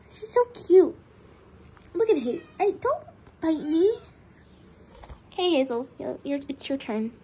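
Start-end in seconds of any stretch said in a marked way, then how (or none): none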